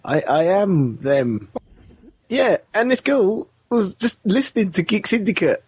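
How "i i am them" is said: halting, low retold admission; stammer, then confess it slowly; slow, sheepish mock reveal; hesitant admission. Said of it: "I'm like".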